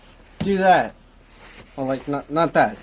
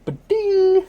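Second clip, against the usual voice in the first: bright high ding; ding like a coin chime; chime sound; 9 dB louder than usual. Pretend. Checking items off list.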